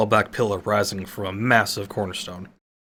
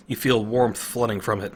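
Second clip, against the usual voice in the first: ordinary delivery, nothing marked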